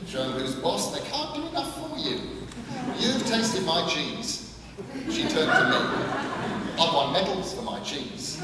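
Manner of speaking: a hint of a brisk old countrywoman, bragging; lightly voiced old woman. A narrator's reading. Gran boasting to the boy about her cheese, lightly voiced old woman.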